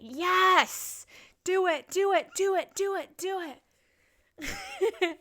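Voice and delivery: high, loud, rapid-fire urging; excited cheering. Real, not acted. Egging on a listener about nomad life, excited cheering. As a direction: cheer them on like a one-person crowd.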